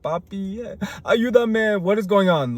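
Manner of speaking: high whining call to Papi; whiny plea. Retold or imitated. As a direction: play the crybaby, high and pleading. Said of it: "I'm such a whiner".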